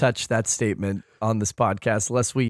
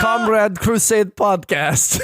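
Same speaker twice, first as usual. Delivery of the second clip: slow, higher hammed-up Russian accent; 8 semitones higher, 4 dB louder, slower than usual. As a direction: drag out the show name in a mock Russian accent. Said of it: "[name] do a Russian accent".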